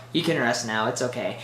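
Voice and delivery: soft, low, soothing farewell; calm and tender. Retold or imitated. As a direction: comfort a dying man gently. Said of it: "Pepper's perfectly calm and collective".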